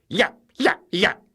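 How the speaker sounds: high, loud yapping; small dog yapping. Acted. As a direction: yap like a scruffy terrier. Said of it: "said Hairy Maclary".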